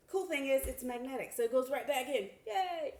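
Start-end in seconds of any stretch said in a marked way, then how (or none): 2.5-2.9 high, bright little cheer. Real, not acted. To viewers as the magnet clicks back in, small high cheer. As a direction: celebrate the magnet snapping back.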